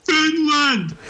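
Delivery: high-pitched cartoon shout, drawn out; high cartoon holler; Patrick shouting. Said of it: "Patrick shouting".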